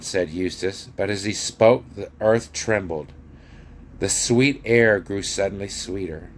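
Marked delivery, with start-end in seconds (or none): none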